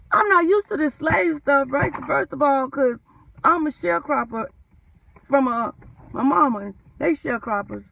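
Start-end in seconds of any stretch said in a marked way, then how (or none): none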